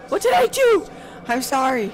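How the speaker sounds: high, loud panicked yelp. Real, not acted. Player startled by the game yelps an apology, panicked. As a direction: cry out in startled alarm.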